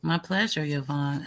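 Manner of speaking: usual speaking voice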